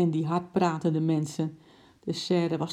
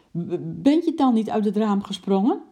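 high, loud, stuttered question; flabbergasted wife; astonished stammer; 5 semitones higher, 5 dB louder than usual. A narrator's reading. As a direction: ask in stammering disbelief.

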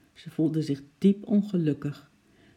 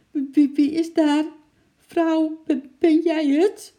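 high, loud stammering cry; frightened calling out; 12 semitones higher, 7 dB louder, slower than usual. A narrator's reading. Sick man in bed calls to intruders, scared and stammering. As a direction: shout in fright, stuttering. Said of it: "riep die arme meneer Hakke uit zijn bed".

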